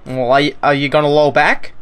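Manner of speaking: ordinary delivery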